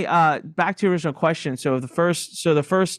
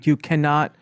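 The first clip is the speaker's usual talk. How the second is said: ordinary delivery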